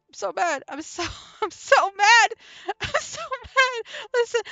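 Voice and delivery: high voice breaking into real laughter; laughing head off. Real, not acted. Upset the recording was lost, laughing their head off. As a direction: claim anger while cracking up. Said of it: "I'm laughing my head off".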